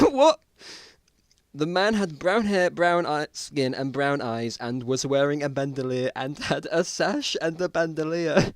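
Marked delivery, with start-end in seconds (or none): 1.5-8.5 reading on with a suppressed giggle; still amused. Real, not acted. Reader rereading the line, barely holding in giggles. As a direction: fight back the laughter.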